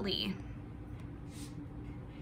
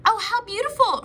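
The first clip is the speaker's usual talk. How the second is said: gasped delight; 9 semitones higher than usual. Acted. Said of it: "Frenzy gasps".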